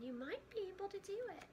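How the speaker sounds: high, quick hopeful thought. Acted. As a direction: think it over eagerly. Voices Frog thinking.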